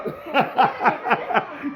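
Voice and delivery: high rippling laugh; real long laugh. Real, not acted. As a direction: burst into high, amused giggles. Amused by a child's pronunciation.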